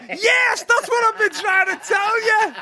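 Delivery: high, loud laughing cry; laughing shout. Pretend. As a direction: blurt it out laughing. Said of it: "He'd have either gone".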